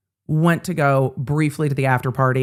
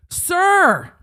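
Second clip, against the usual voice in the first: mock holler; 13 semitones higher than usual. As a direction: call out Sir like a drunk dude from a truck window. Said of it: "starts hollering at me".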